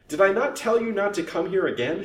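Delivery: high, loud scolding question; furious. A narrator's reading. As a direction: round on the unwelcome visitor furiously. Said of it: "turned furiously on the old lace vendor".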